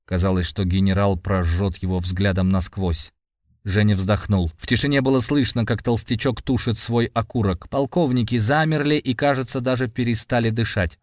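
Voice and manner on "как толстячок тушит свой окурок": ordinary delivery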